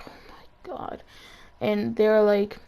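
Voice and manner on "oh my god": breathless whispered oh my God; whispered dread. Retold or imitated. Dread when teacher calls.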